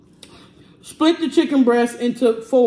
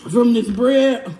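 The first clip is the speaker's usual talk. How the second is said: normal talking voice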